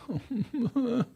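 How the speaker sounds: bright burst of high laughter; short high laugh. Real, not acted. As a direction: laugh up high before starting. Opening laugh before story.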